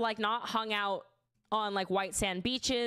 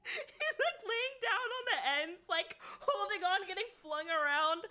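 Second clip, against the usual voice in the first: talking through laughter, pitched up; real laughter, higher voice; laughing; 8 semitones higher than usual. Real, not acted. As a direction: describe it while cracking up. Laughing describing John.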